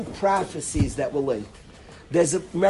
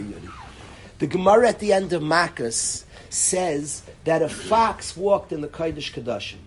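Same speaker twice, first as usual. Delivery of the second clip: ordinary talk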